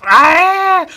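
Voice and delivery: shrill pained yell; sudden loud high cry; high scream. Retold or imitated. Character screams in fight.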